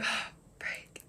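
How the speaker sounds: breathless whisper, barely voiced; whispered curse. Whispering to herself after remembering what was in her bag. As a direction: hush it under your breath in disbelief. Stunned reaction to forgotten bag.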